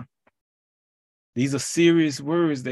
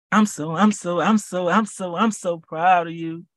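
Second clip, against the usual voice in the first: excited; 4 semitones higher than usual. Real, not acted. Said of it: "preaching climax".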